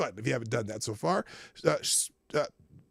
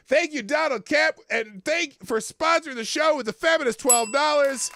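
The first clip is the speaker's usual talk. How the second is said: excited; 10 semitones higher, 6 dB louder than usual. Real, not acted. Excited thanks for a donation.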